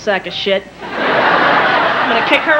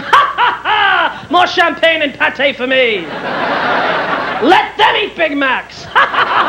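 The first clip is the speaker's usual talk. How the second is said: grand, drawn-out demand between theatrical laughs; mock haughty diva; 3 semitones higher, slower than usual. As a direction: cackle and command like decadent royalty. Imagined haughty diva at Caviar Palace.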